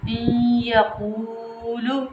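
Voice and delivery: "इन यकूलु" very slow, n melted into a nasal held y; ghunna on ya. Shown as a demonstration. Teacher showing how to do ghunna, nasal drawn-out "इन यकूलु". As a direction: hum "इन यकूलु" through the nose, vowels long. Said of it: "कैसे करेंगे".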